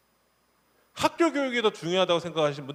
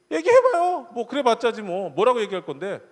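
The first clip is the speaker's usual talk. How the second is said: much higher, louder taunting dare; defiant kid; 5 semitones higher, 7 dB louder than usual. Retold or imitated. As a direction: sneer like a kid who isn't scared. Defiant kids mocking threat. (비아냥거리는 아이)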